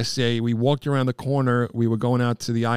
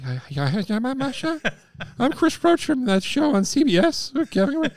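flustered fan babble, pitched higher; starstruck babble; 12 semitones higher than usual. Retold or imitated. Fan meeting his hockey idol, flustered high babble. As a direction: gush at your hero, tripping over words.

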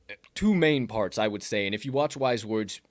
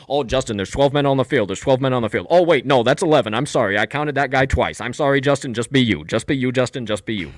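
loud, bumbling, insistent coach; flustered, then pep-talk him again; mock coach headset; 9 dB louder than usual. Mocking Nagy miscounting players to Justin, loud bumbling coach. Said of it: "coming through the headset".

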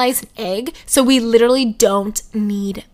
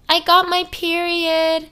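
higher, drawn-out announcement; announcing; 6 semitones higher, slower than usual. Retold or imitated. Daughter telling dad her news, announcing. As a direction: break the news slowly in a raised pitch. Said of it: "And I was like".

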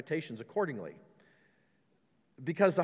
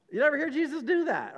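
usual speaking voice

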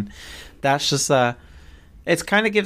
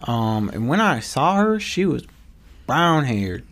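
put-on Utah accent; 5 semitones higher than usual. Co-host imitating the guy Jodi saw in Utah, mock Utah accent. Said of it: "It was a Utah accent".

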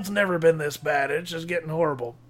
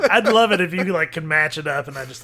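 ordinary delivery

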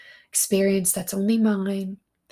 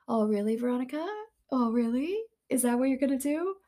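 funny teasing voice; 5 semitones higher than usual. Pretend. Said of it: "I make funny voices".

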